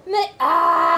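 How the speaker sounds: sudden loud cry of frustration; exasperated outburst. Acted. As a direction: burst out, at the end of your rope. Mother snaps at cashier.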